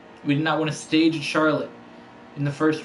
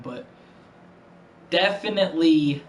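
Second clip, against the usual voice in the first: ordinary talk, nothing marked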